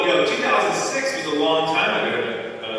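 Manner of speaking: ordinary talk, nothing marked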